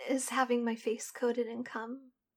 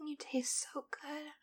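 whispering; 7 dB quieter than usual. Acted. Sensual whispered roleplay.

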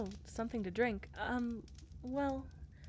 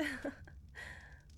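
soft giggle; 6 semitones higher, 8 dB quieter than usual. Acted. Shy laugh.

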